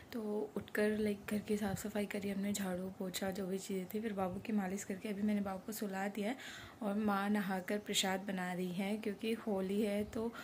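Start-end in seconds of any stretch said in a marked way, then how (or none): 0.1-4.4 breathy unvoiced whisper, very soft. Real, not acted. Vlogger whispering to viewers while her baby sleeps. Baby asleep, talking softly.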